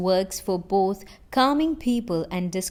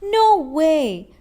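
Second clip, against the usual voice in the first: high, loud burst of disbelief; shocked, thrilled exclamation; excited scream; 7 semitones higher, 7 dB louder than usual. Acted. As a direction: cry out at amazing news. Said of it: "You scream".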